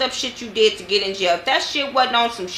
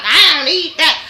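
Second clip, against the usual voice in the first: mock indignant reply; 7 semitones higher, 6 dB louder than usual. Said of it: "She's like".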